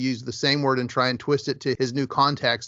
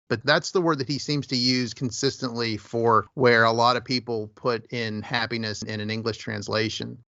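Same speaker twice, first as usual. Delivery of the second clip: usual speaking voice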